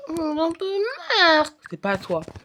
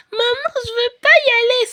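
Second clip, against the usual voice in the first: put-on high, whining toddler voice, stamping her foot; a sulky four-year-old refusing; whiny little girl; 11 semitones higher, 6 dB louder than usual. Sophie begs mother. (petite fille qui pleurniche, voix aiguë)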